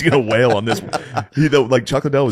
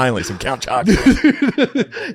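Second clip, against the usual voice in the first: high, slow, laughing put-on voice; hammed-up happy fighter over his cereal; mock fighter voice, laughing; 10 semitones higher, 3 dB louder, slower than usual. Friend mocks a fighter rewarding himself with cereal, laughing. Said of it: "He's like".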